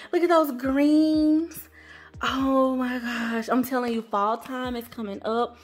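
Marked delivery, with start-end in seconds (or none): none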